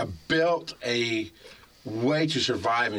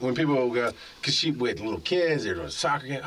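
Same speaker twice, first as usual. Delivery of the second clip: usual speaking voice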